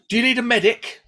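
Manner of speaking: high loud mock woman's voice; concerned woman. Asking the fallen man, a high loud fussing woman. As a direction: pitch it way up like a fussing stranger. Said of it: "she goes".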